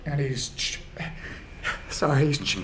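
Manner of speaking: breaking off mid-word, overcome; choked up. Real, not acted. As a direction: try to speak through real tears. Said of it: "I'm getting choked up".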